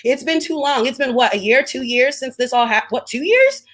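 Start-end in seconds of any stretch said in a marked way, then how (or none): 2.9-3.6 double-take, rising sharply; incredulous question. Real, not acted. Working out how long it's been, incredulous. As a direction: voice leaping high at the end.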